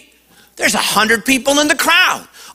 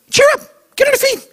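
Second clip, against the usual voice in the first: crowd calling out; much faster than usual. Retold or imitated.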